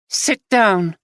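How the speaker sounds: wife ordering husband to sit, abrupt. Acted. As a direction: cut him off short. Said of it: "she said abruptly".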